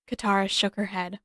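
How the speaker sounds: ordinary delivery, nothing marked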